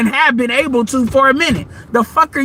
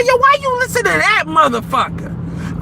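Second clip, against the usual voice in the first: incredulous, higher; higher, exasperated retold retort; retold outrage; 8 semitones higher than usual. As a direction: challenge him in disbelief. Retells own outraged reply.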